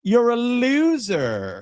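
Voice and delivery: put-on high, slow Valley Girl sneer; mocking Valley Girl taunt. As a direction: drag it out like a snotty Valley Girl. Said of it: "Sounds like a Valley Girl".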